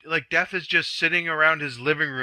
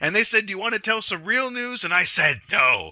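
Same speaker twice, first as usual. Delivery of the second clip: ranting anchor; 8 semitones higher than usual. Retold or imitated.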